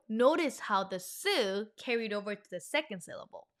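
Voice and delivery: usual speaking voice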